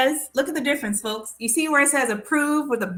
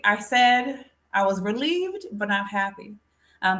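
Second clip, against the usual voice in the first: ordinary talk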